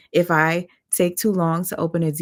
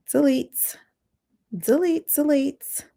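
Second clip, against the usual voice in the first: squeaky delete; 7 semitones higher, slower than usual. Real, not acted. Testing live filter voice.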